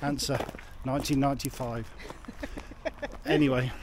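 ordinary talk, nothing marked

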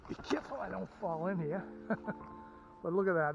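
ordinary talk

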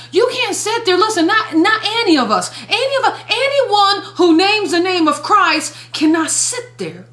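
high urgent exhortation. Real, not acted. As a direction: press the point, pitch climbing. Preacher exhorting congregation, pitch jumps.

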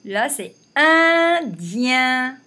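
drawn out, each syllable held; teacher's slow demo word; slow model reading. Shown as a demonstration. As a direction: sound it out slowly for children. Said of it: "Donc, on l'entoure".

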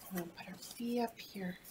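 fast hushed aside; whispering. Real, not acted. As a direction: whisper it quickly to yourself. Whispered aside while working.

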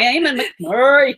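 loud put-on Irish brogue. Shown as a demonstration. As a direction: try a thick Irish accent. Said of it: "Give me a nice thick Irish brogue".

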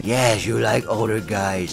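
put-on low, soft teasing old man; deep quiet old man; old guy.

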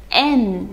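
"n" said "em" with lips closing at the end; letter name demo. Shown as a demonstration. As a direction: finish "n" on a closed-lip hum, as "em". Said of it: "最后我们嘴巴是要闭上的". (读“n”作em，最后闭上嘴唇)

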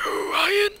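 very high, thin, whispery squeal; silly strangled falsetto whisper; weird voice. Pretend. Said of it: "Wow, that was weird".

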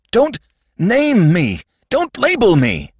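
high, loud terrified protest; acted scream. A narrator's reading. Sandman terrified of being named, screaming. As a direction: scream it out in panic. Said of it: "screamed the Sandman".

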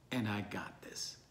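very low, soft near-whisper; hushed reassurance. Acted. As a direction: close softly, almost whispering the promise. Closing reassurance in Jesus' words.

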